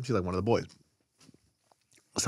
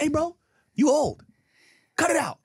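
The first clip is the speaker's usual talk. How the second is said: blunt mock callout. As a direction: tell him off, half joking. Imagined blunt reality check.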